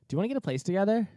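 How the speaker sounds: imitated girl, high, fast and loud; eager proposal. Girl asking her new date to move in, eagerly. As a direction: rush it out in a perky mock-girl voice. Voices girl's sudden proposal.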